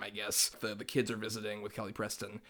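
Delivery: ordinary talk, nothing marked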